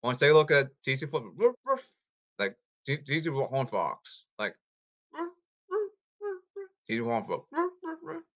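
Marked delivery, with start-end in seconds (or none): 1.4-1.9 dog bark imitation. Barking like a bulldog. 5.7-6.4 high yapping woofs; high dog yips. Pretend. Playful imitation of a small dog yapping. 7.5-8.2 high yapping woofs, slightly softer; high dog yips. Pretend. Playful imitation of a small dog yapping.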